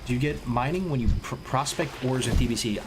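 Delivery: normal talking voice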